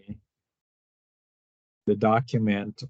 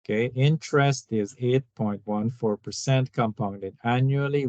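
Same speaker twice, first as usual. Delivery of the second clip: ordinary talk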